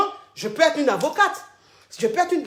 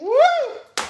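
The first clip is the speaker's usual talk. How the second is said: very high rising "ooh"; high scandalised hoot; astonished whoop; 11 semitones higher than usual. Real, not acted. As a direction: whoop in shocked realisation. Host reacts to repeated answer.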